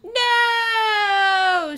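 high loud screaming no; mother screams in horror. Acted. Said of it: "she screamed".

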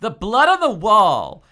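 high, loud accusation; hammed-up, stretched "wall"; dramatic reveal. A narrator's reading. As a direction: proclaim the damning clue. Dramatic reveal in acted confrontation.

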